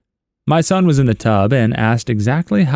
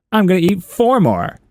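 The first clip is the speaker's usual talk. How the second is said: put-on much higher, playful child; cheeky kid voice; 8 semitones higher than usual. Child outbidding the parent on beans, high cheeky. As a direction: bounce it up like a smug little kid.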